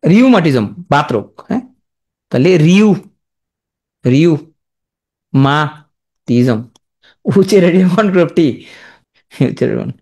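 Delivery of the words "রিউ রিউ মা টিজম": "রিউ ... মা ... টিজম" with long pauses; broken into syllables. Shown as a demonstration. Teacher sounding out a disease name, chunked রিউ মা টিজম. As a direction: say রিউ, মা, টিজম as separate pieces. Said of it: "আমি উচ্চারণ করে লেখো".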